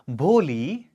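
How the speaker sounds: quiet gentle call; soft and soothing. Acted. Teacher gently calling the sad girl. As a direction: call her name softly. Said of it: "the teacher's voice was so soft and soothing".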